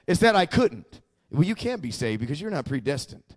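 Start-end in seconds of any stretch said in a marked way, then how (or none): 1.3-3.2 low, soft voice of the accuser; accusing inner voice. Retold or imitated. Accuser talking a new believer out of salvation, low hushed verdict. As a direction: quietly state it as a settled verdict. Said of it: "it didn't matter what he said".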